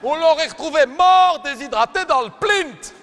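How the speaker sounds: slow, high, loud comic outrage; outraged shout. Acted. Gym teacher ranting at pupils, slow loud outraged shout. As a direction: bellow it out, drawing each word.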